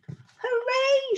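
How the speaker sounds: high, excited cheer; shouted hooray. Acted. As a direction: cheer it out together. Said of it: "shouted the little beaver".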